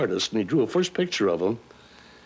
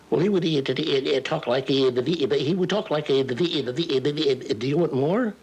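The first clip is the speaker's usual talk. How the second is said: higher, stuttering cartoon pig voice that keeps tripping on "the"; Porky Pig; 5 semitones higher than usual. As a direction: stammer on and on like a little cartoon pig, ending in a laugh. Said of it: "that's when I started doing Porky Pig".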